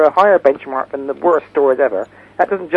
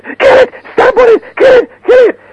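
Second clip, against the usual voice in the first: shrieking; 10 dB louder than usual. Retold or imitated. Aunt voiced demanding spider killed.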